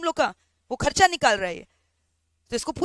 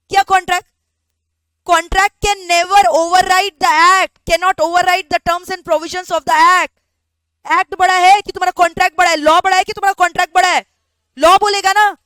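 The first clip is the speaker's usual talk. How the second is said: indignant lecture; 4 semitones higher, 8 dB louder than usual. Retold or imitated. Employee lecturing employer on law.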